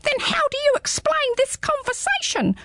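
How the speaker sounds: high, small squeaky creature; a tiny curious hedgehog peering up; high little hedgehog, puzzled. Acted. Hedgehog speaking.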